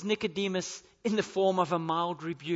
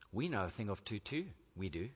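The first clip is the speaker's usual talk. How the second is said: softer, dry mock of a know-it-all; Jesus gently mocking Nicodemus's claim, softer and sarcastic; 8 semitones lower, 8 dB quieter than usual. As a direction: tease him with a quiet sarcastic echo. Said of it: "quite humorously, quite sarcastically".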